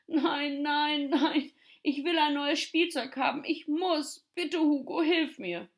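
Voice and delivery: put-on high-pitched, upset child's wail; tearful pleading. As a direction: whine and beg as if starting to cry. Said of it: "Tommy begann zu weinen".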